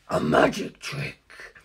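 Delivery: low, slow, breathy growl; unimpressed deep drawl; Kaz's deep voice. Acted. Kaz shrugging off Funny's trick, low slow growl. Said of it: "Kaz said".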